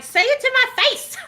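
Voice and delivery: high loud dare; mock confrontational yell; taunting challenge. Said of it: "I'm like".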